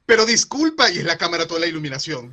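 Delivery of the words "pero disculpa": high, loud indignant excuse me; loud protest. Retold or imitated. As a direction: protest loudly and sharply. Quoting the youtuber's protest.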